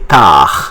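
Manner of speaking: tard with the final D left off; silent D. Shown as a demonstration. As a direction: say "tard" the French way, without D. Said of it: "We don't pronounce once again D".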